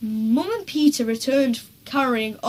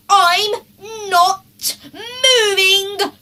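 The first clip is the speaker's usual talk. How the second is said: high, loud wailing refusal; a stubborn child wailing no; Henry refusing to fetch firewood; defiant; 8 semitones higher, 8 dB louder, much slower than usual. A narrator's reading. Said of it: "said horrid Henry".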